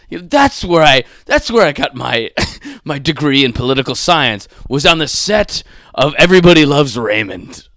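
loud mock-proud declaration, laugh breaking in; mock boasting. Actor claims political science degree from sitcom set, mock boasting. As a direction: belt out the absurd credential.

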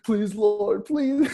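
high-pitched pleading with the Lord; squeaky-high retold begging; mock begging. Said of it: "begging the Lord".

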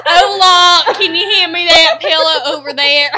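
loud, exaggerated American-accented Spanish; mock a very American "hola", loud through laughter. Player teasing partner's attempt, loud mock American Spanish accent.

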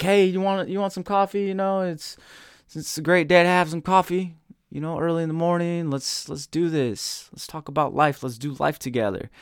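pitched high, eager friendly host offering coffee; cheery host. Retold or imitated. Host inviting a friend for coffee, pitched high and eager. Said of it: "You're like".

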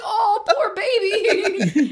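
high, loud, drawn-out cooing; hammed-up pity, stretched very slowly; gushing sympathy. Retelling reaction to the boy's video, exaggerated sympathy. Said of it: "And I was like".

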